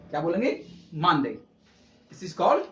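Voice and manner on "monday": मानदेय with weight on मान, light देय; first-syllable stress. Shown as a demonstration. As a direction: stress मान and let देय fall away. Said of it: "इसको stress देना है".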